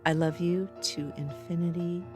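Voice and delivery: ordinary talk, nothing marked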